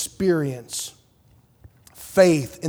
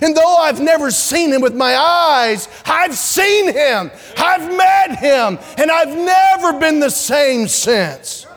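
rising, louder preaching climax; preaching at full force; 8 semitones higher, 3 dB louder, slower than usual. Real, not acted. Preacher to congregation, full ringing testimony. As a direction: build to a fervent peak.

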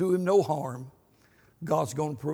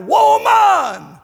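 booming high shout across the house; old man hollering for his wife; 14 dB louder, much slower than usual. Retold or imitated. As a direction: bellow for the wife from the lounge chair. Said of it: "he hollered out".

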